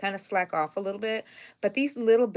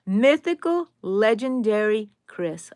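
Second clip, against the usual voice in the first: grand welcome; 6 dB louder, slower than usual. Real, not acted. Hailing a returning viewer.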